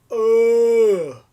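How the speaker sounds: loud exasperated ugh; frustrated groan. Retold or imitated. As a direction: groan it out, fed up. Frustrated at missing piece.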